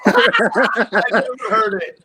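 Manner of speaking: slow words through real laughter; laughing. Real, not acted. Co-host laughing at a guest's confession, slow and amused.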